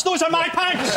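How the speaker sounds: high-pitched cry of recognition; mock outcry. Comic spots his own lost pants, high mock outcry.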